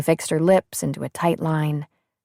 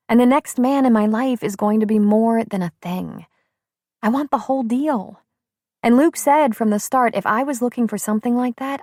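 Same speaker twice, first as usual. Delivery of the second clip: put-on lightly raised young woman's voice; brisk, lively young woman; 7 semitones higher than usual. A narrator's reading. Shelby speaks.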